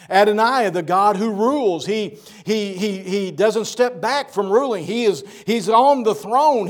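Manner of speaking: loud, ringing preacher's proclamation; preaching at full force. Acted. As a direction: proclaim each name to the congregation. Preaching the names of God.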